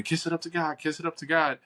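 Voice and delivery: high-pitched mock yell at ordinary volume; mock scream. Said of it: "everybody would be screaming".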